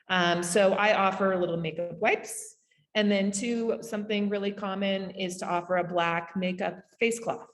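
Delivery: ordinary talk, nothing marked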